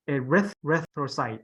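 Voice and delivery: "erythrocyte" stressed on its second part, eryth; stress on second syllable. Shown as a demonstration. Teacher models pronunciation for class, stressing "eryth" in erythrocyte. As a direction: punch the RYTH in "erythrocyte". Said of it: "put the emphasis on the right word".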